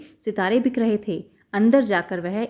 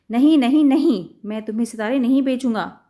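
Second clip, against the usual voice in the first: loud refusal; 3 semitones higher, 4 dB louder than usual. Acted. Shopkeeper refuses loudly.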